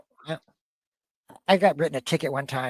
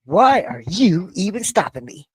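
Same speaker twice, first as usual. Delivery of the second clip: entitled driver voice; 7 semitones higher, 5 dB louder than usual. Retold or imitated. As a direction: act like you're above the law. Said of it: "She's gonna be like".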